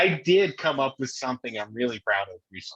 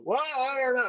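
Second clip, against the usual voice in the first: son's mock retort, high; 11 semitones higher than usual. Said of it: "he'll go".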